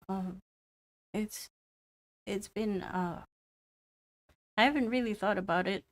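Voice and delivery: lightly voiced boy, halting and unsure; a hint of a shy kid trying to remember; hesitant young boy. Game master voices sick boy Meyer.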